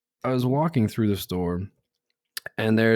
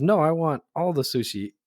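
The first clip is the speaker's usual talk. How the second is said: higher voice mimicking a demanding child; little girl; 6 semitones higher than usual. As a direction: lightly imitate a small girl insisting. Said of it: "And she goes".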